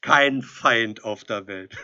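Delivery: a devil laughing at a naive mortal; mocking devil. A narrator's reading. Devil mocks Edelstein, laughing. (spöttischer, lauter Teufel)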